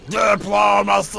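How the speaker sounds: booming one-word bellow; strongman. Acted. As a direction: bark it like a dim brute. Warlord answers with one shout.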